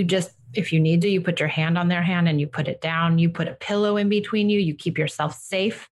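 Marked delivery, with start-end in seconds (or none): none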